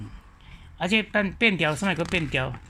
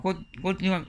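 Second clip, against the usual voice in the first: ordinary talk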